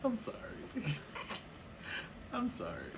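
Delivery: high, squeaky apology through laughter; stifled laughter. Real, not acted. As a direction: apologize while choking back a laugh. Choking with laughter at confession.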